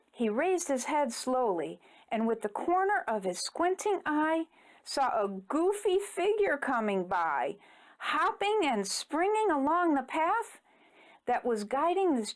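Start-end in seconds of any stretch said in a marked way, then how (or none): none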